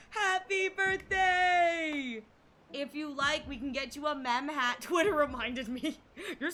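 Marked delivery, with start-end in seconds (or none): 0.1-2.2 long, high, loud cheer; drawn-out shout. Real, not acted. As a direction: belt the birthday wish out slowly. Birthday greeting, drawn out shout.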